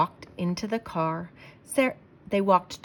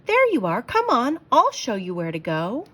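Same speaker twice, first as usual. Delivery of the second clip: higher, louder bubbly welcome; eager principal; 5 semitones higher, 7 dB louder than usual. Acted. Principal hurrying Sarah inside, bubbly.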